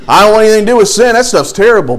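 a hammed-up confident no to sin; mock emphatic rejection. Preacher voicing a spiritual giant who rejects sin, mock emphatic.

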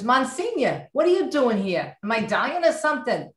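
mock-indignant question in a Jersey accent; mother's Jersey accent. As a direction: imitate an incredulous New Jersey mother quizzing the priest. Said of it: "was always talking like this".

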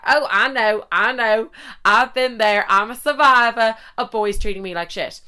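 hammed-up survivor declaration; mock survivor. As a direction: play it up as a proud, wronged survivor. Mock reply to her friends.

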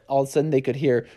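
ordinary delivery, nothing marked

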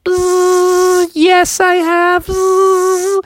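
very high, slow buzzing fly voice. Acted. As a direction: buzz the words like a fly. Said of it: "the fly was like".

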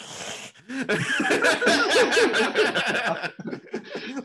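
a long high burst of laughter. Real, not acted. Laughing at the idea.

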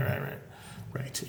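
soft whispered rights; whispering. Real, not acted. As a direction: breathe the words out. Whispered agreement aside.

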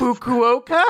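loud, high rising guess; unsure question. Real, not acted. Host attempting a Japanese word, high questioning guess. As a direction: say it as a big question, pitch shooting up.

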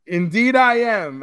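high, loud, drawn-out affirmation; hammed-up dignified answer; mock-proud reply. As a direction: play up a grand, slow yes. Acting out his proud reply.

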